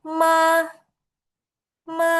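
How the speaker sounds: MA in a high, unwavering tone; high level tone. Shown as a demonstration. Teacher repeating the first tone, MA high and steady. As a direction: keep MA high and flat, no movement. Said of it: "Itu disebut nada pertama".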